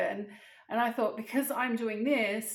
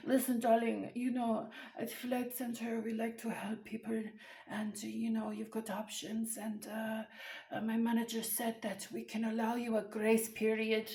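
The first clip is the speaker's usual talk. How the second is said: softer put-on customer service voice; soothing phone agent; 5 dB quieter than usual. Flight Centre agent offers upset caller options, softly soothing. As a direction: gently placate the caller, hushed and measured.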